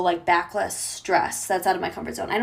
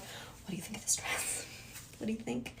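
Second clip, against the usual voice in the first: very soft, whispered fishing for praise; coy whisper; 16 dB quieter than usual. Retold or imitated. Girlfriend walks out in new dress for boyfriend, coy whisper. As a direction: ask it in a hushed, coy voice.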